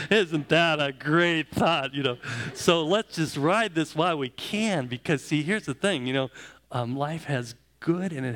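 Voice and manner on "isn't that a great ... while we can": slow, louder words through a chuckle; laughing along at his own joke. Real, not acted. Pastor jokes with the congregation, laughing. Joke about diapers in old age.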